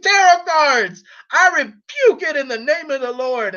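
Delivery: high, hammed-up imitation of a religious rebuke; mock screaming. Vlogger mimicking a woman denouncing the occult, mock screaming. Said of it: "and starts screaming".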